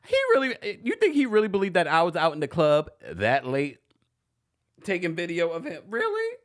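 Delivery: high-pitched disbelief; incredulous. Real, not acted. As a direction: squeal it in shock and denial. Denies rumour, incredulous high voice.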